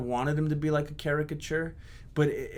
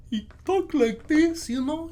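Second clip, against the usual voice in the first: Bob from La Bamba; 12 semitones higher than usual. Shown as a demonstration. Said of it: "I can't even do it anymore".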